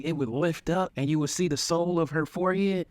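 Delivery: normal talking voice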